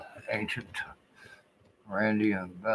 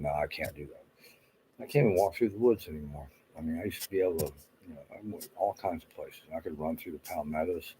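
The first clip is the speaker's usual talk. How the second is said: normal talking voice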